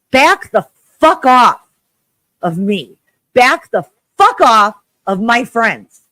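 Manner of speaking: loud, slow, furious shout; real angry scream at an online harasser; furious yelling. Real, not acted. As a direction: yell at the harasser to back off. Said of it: "actually screaming".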